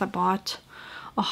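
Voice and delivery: normal talking voice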